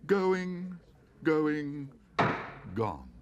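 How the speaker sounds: high loud calls, falling gone; auctioneer calling. A narrator's reading. Auctioneer closes the sale. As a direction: bring the hammer down.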